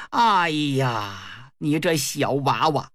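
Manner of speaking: low, drawn-out sigh of disapproval; scornful elder figure. A narrator's reading. Dragon prince rebukes young Nezha, low and scornful.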